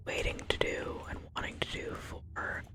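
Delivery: ordinary talk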